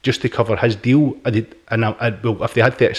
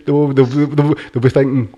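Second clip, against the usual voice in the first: ordinary delivery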